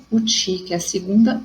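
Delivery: usual speaking voice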